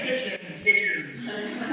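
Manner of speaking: soft, slow fading echo of the word; cave echo. Pretend. Guide mimics echo of cave name.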